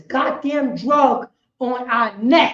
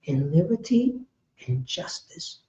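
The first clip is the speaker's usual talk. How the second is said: mock pledge recital; 7 semitones lower, 6 dB quieter than usual.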